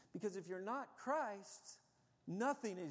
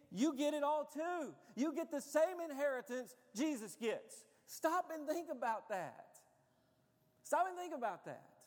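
raised, emphatic pulpit voice; impassioned preaching; 8 semitones higher, 6 dB louder than usual. Real, not acted. Preacher urging congregation to consider their inheritance, impassioned. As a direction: press it on them with high-pitched force.

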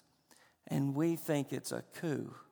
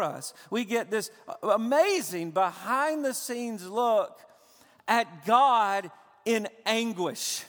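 loud, high, impassioned sermon delivery; preaching at full force; 9 semitones higher, 7 dB louder than usual. Acted. Pastor pressing the Abba cry on his church, impassioned preaching. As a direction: drive it home to the congregation with fervor.

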